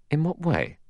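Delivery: high, doubtful question; unsure, lifted voice. A narrator's reading. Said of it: "Armstrong said doubtfully".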